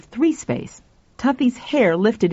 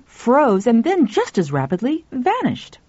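plain reading voice

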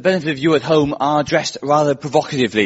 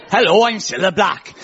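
lilting mock Welsh accent, pitched up; 4 semitones higher than usual. Dating show host introducing herself, mock Welsh accent. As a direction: play the presenter as if from Swansea. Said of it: "we'll pretend Scylla's from Swansea".

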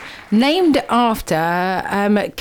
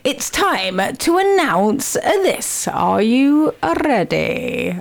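slow, drawn-out build-up to a reveal; dramatic announcement; much slower than usual. Acted. Host teasing the big reveal to listeners, slow and dramatic. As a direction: stretch every word out for suspense.

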